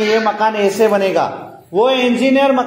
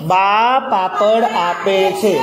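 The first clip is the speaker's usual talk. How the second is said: बा, पापड़, आपे, छे each drawn out separately; slow word-by-word reading; 3 semitones lower, much slower than usual. Shown as a demonstration. Teacher modelling the sentence for pupils, slow and word by word. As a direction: read बा पापड़ आपे छे slowly, spacing every word.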